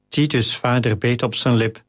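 plain reading voice

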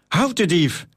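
mild urgent call. A narrator's reading. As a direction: raise the alarm, storyteller style. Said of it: "riep hij naar de mensen om hem heen".

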